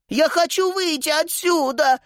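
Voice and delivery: very high, acted tearful wail; crying loudly. A narrator's reading. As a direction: wail it like an upset child. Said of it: "громко заплакала Сузи".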